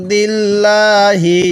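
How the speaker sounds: ইনদিল্লাহি with a thick, full লাম in আল্লাহ; heavy lam. Shown as a demonstration. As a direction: give the লাম weight. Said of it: "এই লামটিকে মোটা করে পড়ব". (লাম মোটা করে পড়া)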